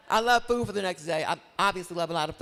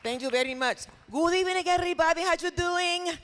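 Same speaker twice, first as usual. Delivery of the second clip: lightly voiced Lupita character, Puerto Rican accent, much higher; 9 semitones higher than usual. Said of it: "that's my other character".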